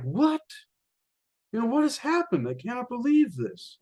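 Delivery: high-pitched shocked hunter, acted out; stunned disbelief. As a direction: play a storybook hunter who can't believe it. Hunter shocked as parrot drops dead.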